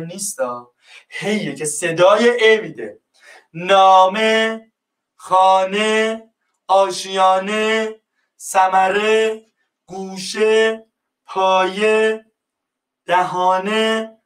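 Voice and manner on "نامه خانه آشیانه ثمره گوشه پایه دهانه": teacher reads examples slowly to show final ه; drawn-out final e. Shown as a demonstration. Slow, one word at a time, ending on e. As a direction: stretch each word, ending on a clear e sound.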